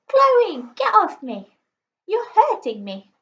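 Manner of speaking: high, loud mock-distressed yell; shouting. A narrator's reading. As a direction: cry out like a little sister faking an attack.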